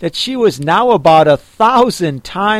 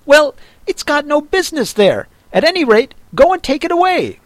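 higher, fast, exasperated master; scolding Rabbit; 10 semitones higher, faster than usual. A narrator's reading. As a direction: scold the dim servant. Rabbit ordering.

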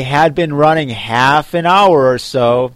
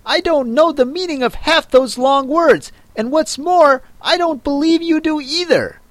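eaglet voice; 12 semitones higher than usual. Acted. Eaglet retorts in character voice.

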